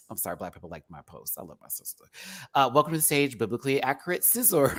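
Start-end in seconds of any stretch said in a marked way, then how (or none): 0.1-2.0 whisper. Real, not acted. Aside, unvoiced.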